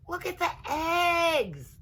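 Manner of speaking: thrilled little gators; excited shout. A narrator's reading. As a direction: squeal at the sight of food. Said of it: "they said".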